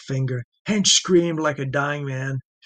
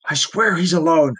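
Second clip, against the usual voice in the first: louder, pleading swear; desperate plea; 3 semitones higher, 6 dB louder than usual. Acted. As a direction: insist through the pain. Tortured prisoner pleading.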